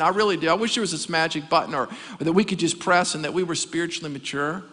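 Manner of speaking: normal talking voice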